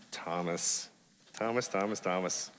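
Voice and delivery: soft, low head-shaking murmur; quietly amused over Thomas; fond sigh. Real, not acted. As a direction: tut over him gently. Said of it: "fond head-shaking aside".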